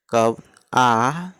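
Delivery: कब आ stretched very slowly, आ held long; slowly sounded-out आ; slow vowel demo. Shown as a demonstration. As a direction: draw out आ slowly so students hear it. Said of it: "कब ई का उच्चारण".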